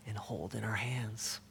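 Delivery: near-whispered close to a quotation; hushed reverence. Retold or imitated. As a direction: let the quote sink to a whisper. Closing the translator's quote gravely.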